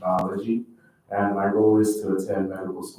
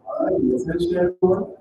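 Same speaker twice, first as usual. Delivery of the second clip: raised, higher call to the room; calling for attention; 9 semitones higher, 5 dB louder than usual. Real, not acted. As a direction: call the chattering room to order. Host calls the room to order.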